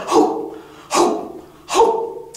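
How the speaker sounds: breathy hooting like a wind instrument; breathy hoo sounds. Acted. Storyteller imitating the music, breathy hoots. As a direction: blow out airy hoots.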